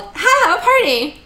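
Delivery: high, drawn-out goofy voice; silly voice. Pretend. Co-host announcing the episode title, silly high voice. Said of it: "Only not in that voice".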